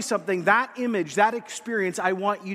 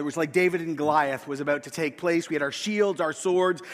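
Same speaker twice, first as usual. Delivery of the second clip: usual speaking voice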